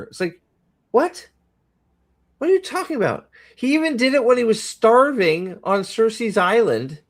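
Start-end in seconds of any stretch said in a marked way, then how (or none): 0.9-5.6 very high, disbelieving what; baffled outburst; incredulous. Real, not acted. Incredulous at the film.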